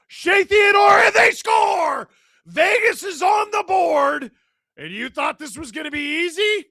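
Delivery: high, loud shouting goal call; excited goal call. Real, not acted. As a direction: yell it like a goal just went in. Goal scored during live stream.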